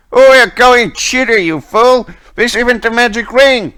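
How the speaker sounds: high, loud quarrelling yell; angry cursing. Acted. Guard cursing a cheating fool mid-ruckus, angry yelling. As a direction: shout it in outraged accusation. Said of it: "ruckus continues in the other room and some curses".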